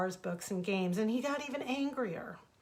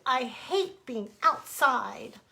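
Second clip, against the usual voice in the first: slow, louder whining complaint; whiny shout; 4 semitones higher, 5 dB louder, much slower than usual. Acted. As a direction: stretch out each word like a sulking child. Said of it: "he cried and shouted".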